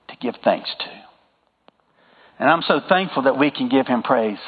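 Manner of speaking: normal talking voice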